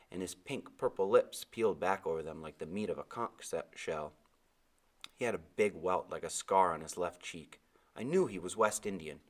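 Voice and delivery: usual speaking voice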